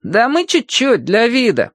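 put-on high-pitched boyish reply; boy's high voice excusing himself; younger brother calling back. A narrator's reading. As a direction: answer back high, playing it down. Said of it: "отозвался сквозь треск младший Лампионов".

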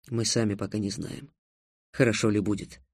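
low, quiet, mildly firm. A narrator's reading. Трикс answers Тиана's idea, quietly firm and low. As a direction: answer soberly, keeping it low. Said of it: "твёрдо ответил Трикс".

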